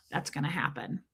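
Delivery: under-the-breath whisper; whispered aside. Real, not acted. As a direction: whisper it as a side comment.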